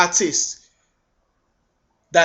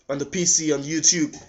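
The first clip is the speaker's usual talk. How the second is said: usual speaking voice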